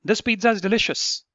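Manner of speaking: high emotion. Shown as a demonstration. Teacher's example telling somebody it tastes very good, emotional. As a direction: exclaim it with some delight. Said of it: "the emotion is very high".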